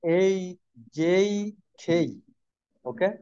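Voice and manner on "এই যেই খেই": "এই, যেই, খেই" each drawn into an ee glide; model pronunciation. Shown as a demonstration. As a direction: say "এই, যেই, খেই" separately, "খেই" aspirated. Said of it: "এটার উচ্চারণ হবে". ("এই, যেই, খেই" আলাদা করে, টেনে উচ্চারণ)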